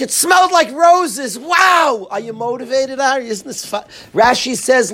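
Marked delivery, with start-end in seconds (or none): 0.0-2.1 shouted, high mock wonder over the roses; mock amazement. Teacher to students, shouting in mock wonder. 2.1-3.8 softer, sarcastic questions to a student; deadpan sarcasm, dropping the volume. Pretend. Rebbe to his talmidim, quietly sarcastic. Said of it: "He's being sarcastic".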